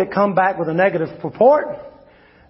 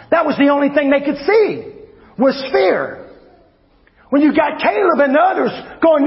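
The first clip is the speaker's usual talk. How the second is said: fiery preaching; 6 semitones higher than usual. Acted. Preacher rousing the congregation against fear, fiery shouting sermon. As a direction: preach it out high and hard to the room.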